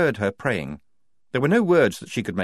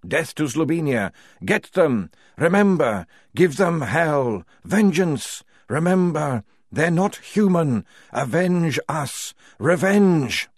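guttural dead voices; 6 semitones higher, 3 dB louder, slower than usual. Acted. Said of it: "deep and guttural".

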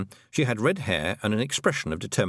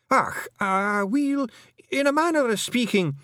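very high Scots squirming; flustered hedging; 13 semitones higher, slower than usual. A narrator's reading. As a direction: hedge nervously, pitched right up. Rob cornered by Tiffany's question.

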